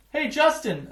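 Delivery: high, loud call across the playground. A narrator's reading. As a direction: call him over from the tetherball court. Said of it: "Emilio calls out from the tetherball court".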